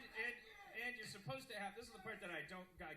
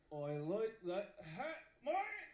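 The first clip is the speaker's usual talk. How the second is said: Australian, lips unmoving; 3 semitones higher, 12 dB louder, much slower than usual. Shown as a demonstration. Demonstrating his technique on stage, stiff-lipped Australian accent. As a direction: say it Australian without moving lips or tongue. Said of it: "Don't move your lips or your tongue".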